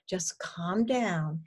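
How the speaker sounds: slow, drawn-out soothing; acted reassurance, unhurried; calming healthy voice. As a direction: gently talk the worry down. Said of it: "that's the job of the healthy voice".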